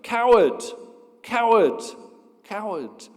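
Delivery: loud, high echo that fades away. Acted. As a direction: bounce the insult back, dying out. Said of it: "He receives the answer".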